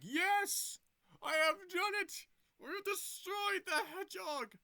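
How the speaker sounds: high, loud triumphant villain shout; gloating villain. Retold or imitated. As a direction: crow victory like a cartoon villain. Villain gloats in retold movie.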